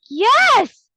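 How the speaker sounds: high, loud preteen "Yes"; daughter's emphatic yes. Retold or imitated. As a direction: blurt it out like a fed-up eleven-year-old. Daughter answering mom's question.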